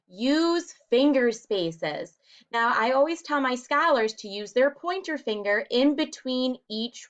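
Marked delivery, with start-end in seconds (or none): 0.1-2.2 slow chart reading. Shown as a demonstration. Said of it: "Number two".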